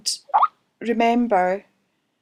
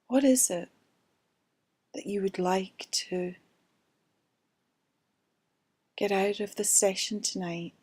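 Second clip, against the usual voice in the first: guided meditation. Acted. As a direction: ease them into calm, gently. Leads a guided relaxation.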